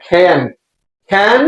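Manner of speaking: CAN with a long stretched vowel; long A sound. Shown as a demonstration. Teacher drilling students, CAN drawn out long. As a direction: hold the A in CAN. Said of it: "লম্বা এ sound, লম্বা".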